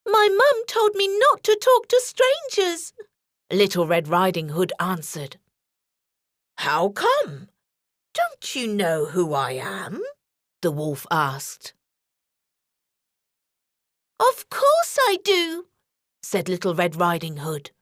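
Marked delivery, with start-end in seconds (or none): 0.1-2.9 put-on higher girlish voice; girl answering the wolf, higher; little girl. A narrator's reading. 6.6-10.0 put-on wolf questioning the girl, lower. A narrator's reading. 14.2-15.7 put-on higher girlish voice; girl answering the wolf, higher; little girl. A narrator's reading.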